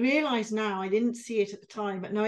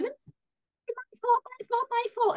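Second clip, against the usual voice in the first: much higher, whiny "my fault" on repeat; panicked self-blame; 13 semitones higher than usual. Retold or imitated. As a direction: squeak out "fault" as if spiralling into blame. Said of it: "if you stop going".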